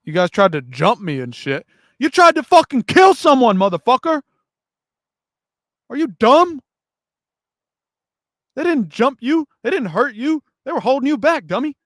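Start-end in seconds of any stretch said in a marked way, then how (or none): 2.0-6.6 loud, high angry yell; angry shouting. Real, not acted. Ranting at the kid. 8.5-11.8 angry. Real, not acted. Ranting at the kid.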